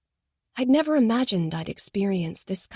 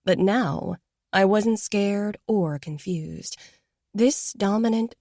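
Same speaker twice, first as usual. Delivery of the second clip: ordinary delivery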